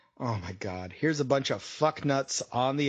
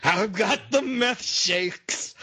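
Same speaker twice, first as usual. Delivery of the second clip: loud, drawn-out cry, pitched up; mock outburst; 5 semitones higher, 3 dB louder, much slower than usual. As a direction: belt it out slow and high, mocking. Mock outburst imitating someone onstage.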